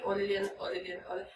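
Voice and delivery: flat, quiet neutral greeting. Retold or imitated. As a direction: say her name coldly, without warmth. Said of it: "en el neutro es". (saludo neutro y frío, más bajo)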